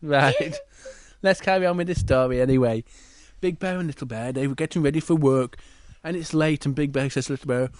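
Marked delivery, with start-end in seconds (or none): none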